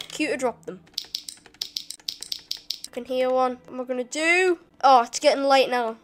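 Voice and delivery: ordinary talk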